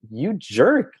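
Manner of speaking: high, scornful jab at herself; mock scorn aimed at myself; self-scolding. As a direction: scold yourself sharply, inner voice. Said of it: "And what I noticed was like".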